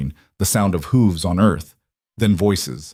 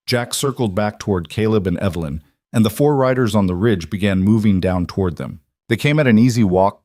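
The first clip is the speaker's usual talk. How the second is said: plain reading voice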